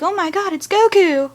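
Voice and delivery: excited fan reaction. Retold or imitated. Fan spotting a Dragon Ball Z likeness, excited.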